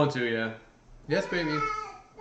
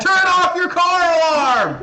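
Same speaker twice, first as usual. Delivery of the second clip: laughing, loud, slow yell; mock angry yell; 9 semitones higher, 8 dB louder, slower than usual. Annoyed neighbor yelling at a car owner, mock angry shout. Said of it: "You're just like".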